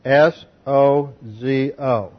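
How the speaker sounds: slow letter-by-letter spelling of sozo; spelled out slowly. Shown as a demonstration. Preacher spelling the Greek root for his hearers, slow letter by letter. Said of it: "from sozo".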